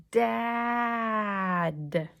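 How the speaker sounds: Dad blended in one smooth sweep. Shown as a demonstration. Teacher shows class the smooth way, one blended word. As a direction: glide through Dad without a break. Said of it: "Smooth one more time".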